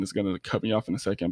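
rushed, racing the clock; talking fast. Real, not acted. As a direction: hurry through it before it cuts out. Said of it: "I'm talking fast".

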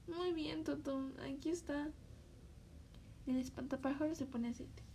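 ordinary talk

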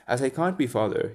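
plain reading voice

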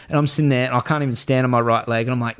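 ordinary talk

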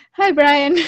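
high, loud hello; mock eager greeting. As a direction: call out a sudden punchline greeting. Acting Chandler greeting Brian as punchline.

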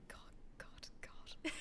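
hushed, whispered mock despair; mock dismayed whisper. Imagined Austrian listeners reacting to their German, whispered dismay. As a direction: whisper it like a cringing listener's private thought.

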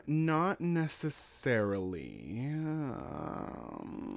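slow, creaky vocal fry drag. Acted. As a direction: drop into a deliberate vocal fry. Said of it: "Intentional, terrible vocal fry".

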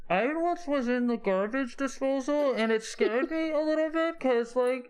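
high, thin rambling voice; high squeaky robot voice. Acted. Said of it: "you just hear like".

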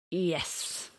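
mildly grumbled, sighing yes; angry grumble. Acted. Old man gives in to JC, grumbling. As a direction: grumble it out on a sigh. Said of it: "grumbled the old man with an angry sigh".

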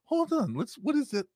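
inner voice. Retold or imitated. Brain voiced in a story.

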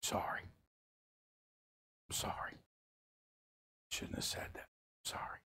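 soft near-whisper apology; child's quiet apology after crying; tearful apology. Retold or imitated. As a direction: say sorry in a hushed little voice. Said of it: "she looks at him and she says".

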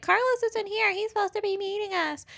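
lightly voiced anxious girls; a hint of fretting bridesmaids; mock worried friends. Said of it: "And they're like".

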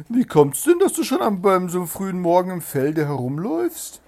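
high, loud, scornful hare; haughty sneer. A narrator's reading. Hare sneering at the hedgehog in the field, scornful. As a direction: look down on him and sneer it loudly. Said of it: "eine gewaltig höhnische Miene".

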